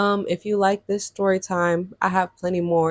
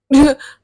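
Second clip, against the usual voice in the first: burst of laughter; 6 semitones higher, 9 dB louder than usual. Real, not acted. Laughs at own joke.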